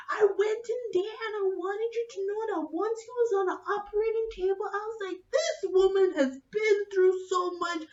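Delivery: put-on high, emotional drunken boasting; drunk doctor. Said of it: "He is drunk".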